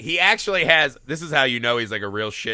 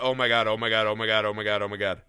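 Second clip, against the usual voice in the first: dread mutter; 8 semitones lower, faster than usual. Retold or imitated. Viewer dreading the scene.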